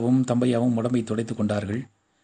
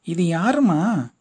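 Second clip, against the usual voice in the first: bewildered; 5 semitones higher, 3 dB louder, much slower than usual. A narrator's reading. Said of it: "Mangammal திகைப்போடு கேட்டாள்".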